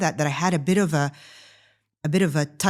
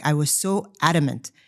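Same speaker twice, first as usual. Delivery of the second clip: ordinary talk, nothing marked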